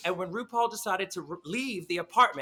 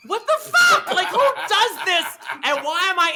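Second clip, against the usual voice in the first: very high, loud disbelieving shriek through laughter; real incredulous laugh-yell; laughing outburst; 10 semitones higher, 8 dB louder than usual. Real, not acted. Baffled by Bunny's apple prank call, shrieking with laughter.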